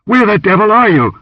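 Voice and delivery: high, loud, urgent search call; shouting. A narrator's reading. Warrior calls after the missing dancer, shouting. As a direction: holler for someone who has vanished. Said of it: "he shouted suddenly".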